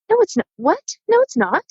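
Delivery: high-pitched, disbelieving protest; incredulous denial. Retold or imitated. Witness retelling reply to crash driver, incredulous. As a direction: refuse to believe it, voice jumping up.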